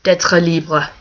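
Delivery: put-on quoted in French accent; French quote. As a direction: say it with French vowels.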